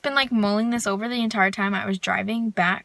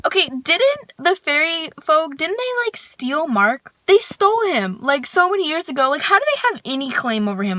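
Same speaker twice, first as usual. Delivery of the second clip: incredulous rant; 6 semitones higher than usual. Real, not acted. Ranting at the fairy folk about Mark, high and incredulous. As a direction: pitch climbing high in disbelief.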